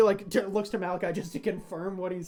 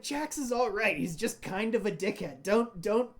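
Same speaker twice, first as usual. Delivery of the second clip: put-on easy reassurance about a troublesome kid; Malachi's voice; 4 dB louder than usual.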